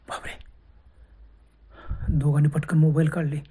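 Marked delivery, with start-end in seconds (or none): 0.1-0.5 a frightened gasp of a word, barely voiced; whispered dread; scared whisper. A narrator's reading. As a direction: breathe it out in a hushed whisper. Horror story suspense moment.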